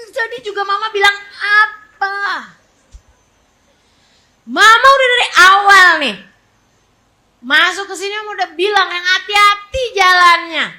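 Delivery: high, exasperated mock telling-off; scolding mother. Mother scolding a child who spilled milk, acted exasperation.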